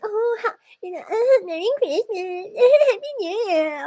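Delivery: high, sugary mock impression of the coworker; mocking fake friendliness. Mocking the coworker's fake holiday greeting, in a high sugary voice. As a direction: mimic her fake cheer with a laughing squeak. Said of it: "your freaking fake ass".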